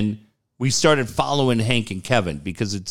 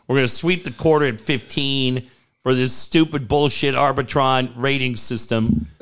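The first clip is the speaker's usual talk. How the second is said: usual speaking voice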